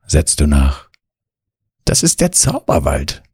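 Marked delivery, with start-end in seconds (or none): 1.8-3.2 purring cat. A narrator's reading. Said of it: "schnurrt Mieze".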